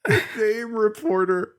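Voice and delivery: lightly voiced dopey henchman; dopey henchman voice echoed. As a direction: repeat it slowly, half in character. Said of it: "Rocksteady goes".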